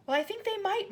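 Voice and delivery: put-on high hopeful girl; excited child. A narrator's reading. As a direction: rush it out hopefully. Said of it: "Matilda cried".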